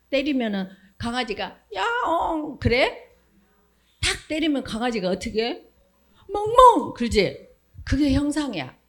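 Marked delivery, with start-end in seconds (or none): 1.7-2.6 mock meow. Dog wrongly meowing, joke. 6.3-6.9 high, loud playful 멍멍; a sharp, high woof-woof for children; mock dog bark. Dog barking when hit.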